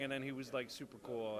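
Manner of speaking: ordinary delivery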